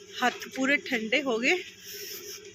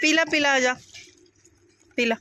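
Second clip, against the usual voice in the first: ਪੀ ਲਾ said higher and louder, coaxing; coaxing the cat; 5 semitones higher, 4 dB louder than usual. Real, not acted. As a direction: urge the cat to drink, bright and loud. Urging cat to drink milk.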